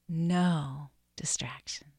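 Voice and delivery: slow whispered prayer; slow breath prayer. Real, not acted. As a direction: breathe each phrase slowly. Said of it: "let's just pray this breath prayer together now".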